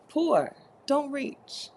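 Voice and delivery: slow mock sermon of the rule; slow, preachy. Shown as a demonstration. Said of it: "People don't get it".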